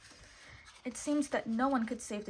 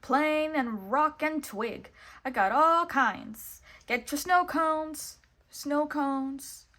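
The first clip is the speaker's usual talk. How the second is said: high, loud goofy vendor calls; Goofy shouting; 6 semitones higher, 6 dB louder, slower than usual. A narrator's reading. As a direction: bellow out snow cones, slowly at the end. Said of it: "his friend Goofy shouted".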